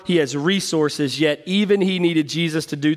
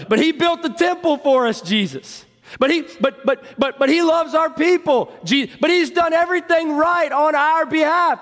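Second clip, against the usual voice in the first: pleading over and over, almost shouting; insistent protest; 10 semitones higher than usual. Retold or imitated. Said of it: "leaders arguing their case".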